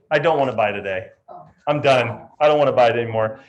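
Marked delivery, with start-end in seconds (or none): 0.1-1.1 low, flat refusal; sulky refusal. Acted. Fickle client flipping moods, now low and sulky. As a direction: drop down into a sulk. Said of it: "change their mind depending on their emotions".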